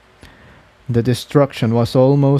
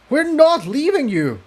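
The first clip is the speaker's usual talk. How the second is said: high, raised shout over noise; shouted over the roar. Acted. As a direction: refuse to leave her behind. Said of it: "Jigsaw shouted over the roar".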